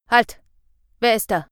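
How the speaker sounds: a startled guard barks out, loud; sharp challenge. Acted. Policeman in the woods startled by Fred, loud challenge. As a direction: call out the challenge into the dark.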